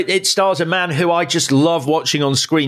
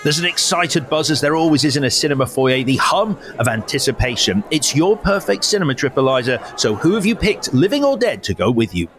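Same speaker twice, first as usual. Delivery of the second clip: scene-setting narration; 4 semitones lower than usual. Acted. Host guiding the guest into her imagined cinema, low storytelling voice. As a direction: paint the foyer for the listener.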